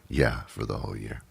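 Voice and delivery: low, hushed aside; quiet confirmation. Acted. Host answering the imagined doubter, low and hushed. Sheepish answer in acted exchange.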